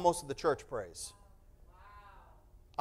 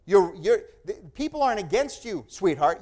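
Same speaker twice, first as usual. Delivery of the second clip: usual speaking voice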